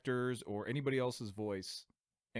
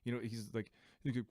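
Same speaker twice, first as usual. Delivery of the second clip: ordinary talk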